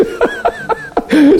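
high wheezy laugh. Real, not acted. Preacher laughs at his own point, amused. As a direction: burst of laughter in mid-talk.

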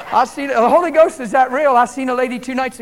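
worked-up preacher telling a miracle; excited testifying. Real, not acted. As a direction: carry the shout into the story, easing gradually. Excited testimony after outburst.

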